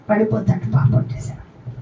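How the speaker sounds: ordinary talk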